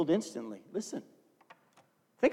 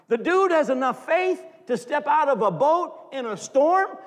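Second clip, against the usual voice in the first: higher, louder, slowed protest; incredulous disbelief; 8 semitones higher, 6 dB louder than usual. Real, not acted. Preacher to congregation, amazed at the rebuke. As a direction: sound baffled that this is called little faith. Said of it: "Hang on for a second".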